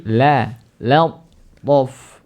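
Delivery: "lattaf" slow, with a light "la" before the heavy t; thin lam. Shown as a demonstration. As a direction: start "lattaf" thin on la, thicken only after it. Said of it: "Rendah dulu".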